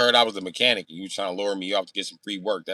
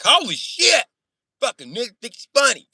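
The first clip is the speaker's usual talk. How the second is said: high, loud, indignant mock outburst; mad man's outburst; 8 dB louder than usual. He was mad at the accent jokes, high indignant outburst. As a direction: act out an offended man blowing up, pitched way up. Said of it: "He was like".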